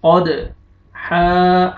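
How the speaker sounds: कद with an echoing bounce on the d, then हा held long; qalqala on dal. Shown as a demonstration. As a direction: bounce the d of कद, stretch हा. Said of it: "double आवाज आएगी दाल की". (कद की दाल पर डबल आवाज़, हा लंबा)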